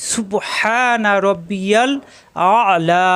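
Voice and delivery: stretched সুবহানা রব্বিয়াল with clear h; drawn-out model reading; slow correct recitation. Shown as a demonstration. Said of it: "এভাবে পড়লে হবে না".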